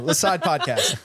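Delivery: giggling through the words; laughing. Real, not acted. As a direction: laugh out the joke. Laughing joke.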